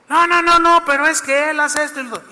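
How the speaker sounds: loud, high insistent protest; protesting crowd shout. Acted. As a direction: cut him off with a loud no.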